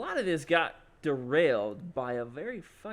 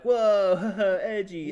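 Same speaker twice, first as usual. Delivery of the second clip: loud, raised, drawn-out whoa with a laugh; mock crowd whoa; 6 semitones higher, 10 dB louder, much slower than usual. Everyone reacting to the comedian's threat, mock awe. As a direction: imitate an audience jeering playfully.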